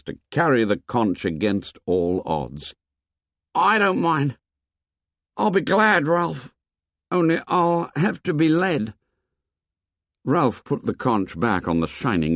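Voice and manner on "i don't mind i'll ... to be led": put-on high, earnest boyish voice; a timid boy offering eagerly; young Piggy, pitched well up. A narrator's reading.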